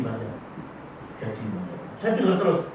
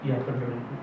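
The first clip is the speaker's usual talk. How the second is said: meek reply; 9 semitones lower, 13 dB quieter, faster than usual. Retold or imitated. Meek man answers pastor.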